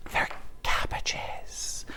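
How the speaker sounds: slow, hushed stage whisper. Pretend. Presenter revealing the roses aren't roses, in a slow stage whisper. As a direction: whisper it like a scandalous secret.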